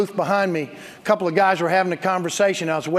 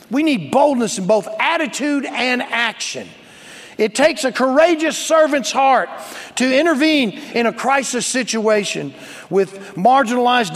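raised, loud pulpit delivery; forceful preaching; 6 semitones higher, 5 dB louder than usual. Real, not acted. Pastor urging the church to courage, forceful preaching. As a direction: preach it boldly with punched key words.

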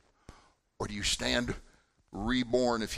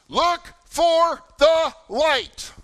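high, slow, full-force shout; screaming back; 12 dB louder, much slower than usual. Retold or imitated. Counselors guide a lost camper back, screaming slowly. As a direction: bellow it word by word so it carries. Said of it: "we started screaming back to the person".

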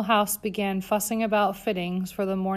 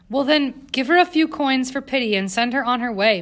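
higher, louder, dismissive disgust; revulsion; 5 semitones higher, 5 dB louder than usual. A narrator's reading. Governess dismissing the girl, disgusted and louder. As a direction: wave the beggar away. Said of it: "her face a mask of revulsion".

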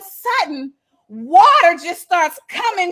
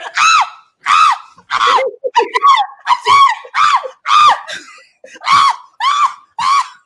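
high shrill-free repeated yelps of panic, much higher and louder; retold frantic screaming, pitched way up; imitated panicked screams; 5 dB louder than usual. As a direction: squeal short cries like a mom flailing in the water. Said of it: "all of a sudden I hear".

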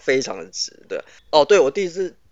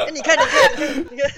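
words squeezed out between fits of laughter; laughing to tears; 10 semitones higher than usual. Real, not acted. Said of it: "笑得我眼泪都出来了".

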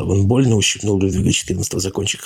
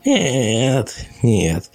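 drawn-out no; 4 semitones higher than usual. A narrator's reading. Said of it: "протянул Людвиг четырнадцатый".

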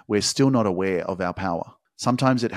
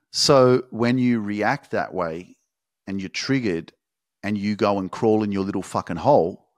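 normal talking voice

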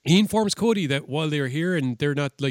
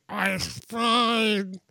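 high, strained put-on voice; drawn-out, hammed-up groan from the ground; Shane McMahon; 7 semitones higher than usual. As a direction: insist it while badly hurt. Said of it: "Shane was on the ground going".

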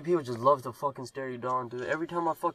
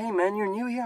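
imitating old coworker; 8 semitones higher than usual. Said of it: "He's like".

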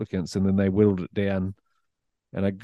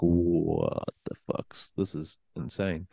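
awestruck inner voice; 5 semitones lower, 7 dB quieter, much slower than usual. Retold or imitated. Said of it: "And I was like".

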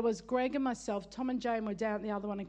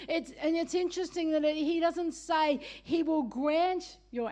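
raised, insistent preaching with stretched key phrases; emphatic preaching; 3 semitones higher, 4 dB louder than usual. Real, not acted. Preacher telling congregation God will listen, emphatic. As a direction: preach it with conviction, leaning on the promise.

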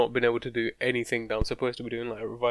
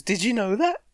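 higher, sharply rising question; mock husband voice; 8 semitones higher, 4 dB louder than usual. Comic mimicking the politician's husband, high-pitched mock question. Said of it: "Whatever her husband name is".

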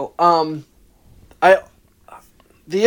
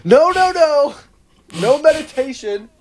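high, loud panicked shouts; panicked yelling; 11 semitones higher, 6 dB louder, slower than usual. Retold or imitated. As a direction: yell it like you're freaking out. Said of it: "people freaking out, being like".

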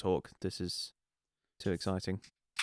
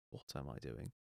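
usual speaking voice

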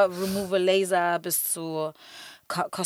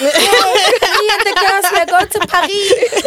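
high, loud, slow computer voice; like a cheerful TikTok robot narrator; chipper text to speech voice; 12 semitones higher, 12 dB louder, slower than usual. Pretend. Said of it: "Mit dieser Computerstimme".

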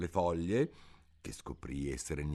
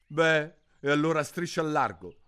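grumbled; 9 semitones higher, 6 dB louder than usual. Acted. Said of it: "brontolò il piccione".

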